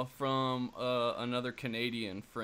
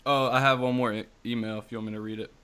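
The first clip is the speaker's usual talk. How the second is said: normal talking voice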